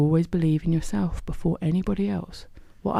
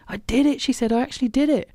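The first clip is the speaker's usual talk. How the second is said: much higher, thrilled and amazed; excited; 8 semitones higher, faster than usual. Acted. Hope reaching the riverbank, high and thrilled. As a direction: burst with joy at success.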